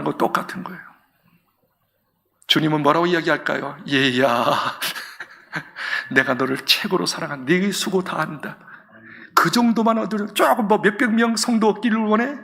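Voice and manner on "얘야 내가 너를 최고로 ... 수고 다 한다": tender Lord. Acted. Lord voiced to preacher.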